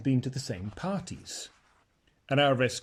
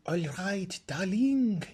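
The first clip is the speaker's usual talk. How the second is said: slow, languid, higher drawl; pampered, languid young lady; lazy voice; 7 semitones higher, slower than usual. A narrator's reading. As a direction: answer as if nothing matters. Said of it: "in her lazy voice".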